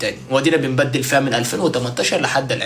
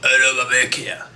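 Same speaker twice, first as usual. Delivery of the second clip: scrap dealer call; 4 semitones lower, 4 dB quieter than usual. Retold or imitated. Imitating scrap buyer call.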